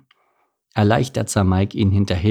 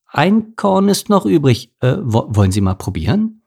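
nervous offer; 6 semitones higher, 4 dB louder than usual. A narrator's reading. Mike offers policeman a bribe.

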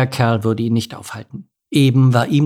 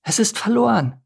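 high moan of despair; dismayed moan; 10 semitones higher than usual. A narrator's reading. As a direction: groan it hopelessly in a high character voice. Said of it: "stöhnte Miri".

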